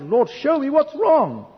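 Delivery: loud, high, drawn-out cry to God; distressed cry. Retold or imitated. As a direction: cry out in acted distress, stretching it slowly. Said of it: "I cried out".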